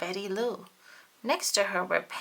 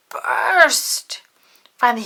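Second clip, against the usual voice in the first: ordinary delivery, nothing marked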